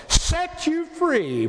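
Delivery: high, drawn-out preacher's cry; ringing, long-held exultation; exultant preaching. Real, not acted. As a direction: stretch it out high in triumph. Preacher exulting, followed by praise God.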